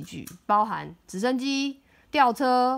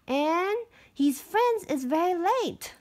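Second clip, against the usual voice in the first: put-on little girl voice; 7 semitones higher than usual. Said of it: "she announced".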